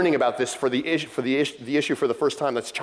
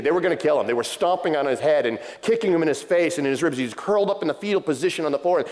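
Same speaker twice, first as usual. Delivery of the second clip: ordinary talk, nothing marked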